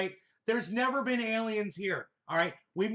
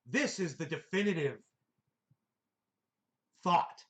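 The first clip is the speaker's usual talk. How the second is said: mock pontificating; 2 semitones lower than usual. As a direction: parody a self-important thinker, pausing for weight. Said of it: "like he's pontificating".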